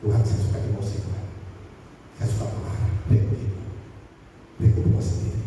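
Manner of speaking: hushed, low confession to God; whispered prayer. Retold or imitated. Pastor retelling his own whispered prayer, hushed and low. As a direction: murmur it like a private prayer. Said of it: "Dan saya berdoa".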